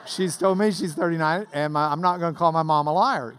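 ordinary talk

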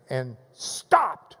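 "stopped" set apart and punched; stress on "stopped". Shown as a demonstration. Preacher showing what the Greek emphasizes, heavy stress on "stopped". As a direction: land hard on "stopped", then leave a silence. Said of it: "it emphasizes the word stopped".

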